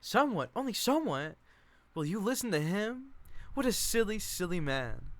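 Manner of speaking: a shrill-sounding mock scold, high and loud; Chick. Chick teasing Doc.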